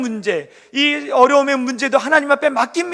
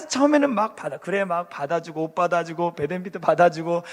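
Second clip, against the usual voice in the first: usual speaking voice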